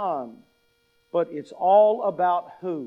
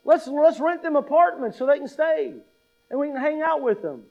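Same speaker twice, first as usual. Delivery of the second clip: much higher, louder, giddy and excited; mock starstruck excitement about hanging out with Moses and Elijah; gushing fan; 7 semitones higher, 4 dB louder than usual. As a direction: gush like a fan meeting heroes. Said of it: "we go".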